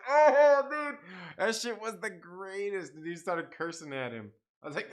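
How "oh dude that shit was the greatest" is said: a much higher, louder burst on "Oh", then settling; hyped-up delight. Retold or imitated. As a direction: gush like the funniest thing ever just happened.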